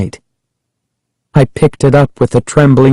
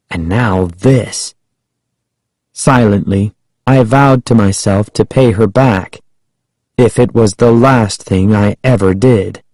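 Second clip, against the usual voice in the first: usual speaking voice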